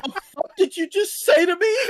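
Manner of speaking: hammed-up indignant shock; mock outrage. Mock outrage at rage bait.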